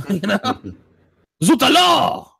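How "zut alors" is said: mock French exclamation, high and loud; hammed-up Frenchman in a fake French accent; exaggerated French accent, pitched up. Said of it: "The French guy would".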